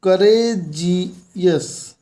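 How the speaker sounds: करेजियस very slowly as करेज then अस; teacher slowly splitting करेजियस into syllables; syllable split. Shown as a demonstration. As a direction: spell out करेजियस in two beats. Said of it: "करेज यहाँ तक हुआ, उसके बाद अस".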